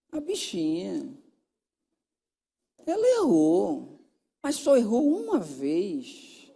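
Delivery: slow, stretched-out mild reproach; drawn-out pleading. Retold or imitated. Counsellor reasoning with the husband about his wife, slow pleading. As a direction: plead her case slowly, lingering on the words.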